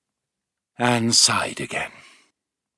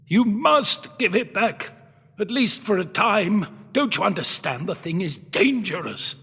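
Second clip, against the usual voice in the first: put-on urgent old professor; 9 semitones higher than usual. Professor urging the owner to return the Yeti, insistent. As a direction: press the warning on him.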